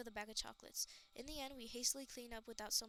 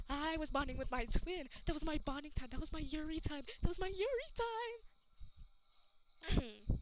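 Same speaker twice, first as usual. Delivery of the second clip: worked-up rant; 4 semitones higher, 7 dB louder than usual. Acted. Continues rant, higher.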